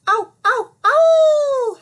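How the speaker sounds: loud, high yowls of pain; howling in pain. Acted. Wolf howling after the door hits his nose. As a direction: howl it like a hurt wolf. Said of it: "howled the wolf".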